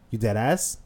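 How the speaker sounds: loud, exasperated telling-off; incredulous big-brother rebuke; loud scolding. Retold or imitated. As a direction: scold a crying little brother loudly.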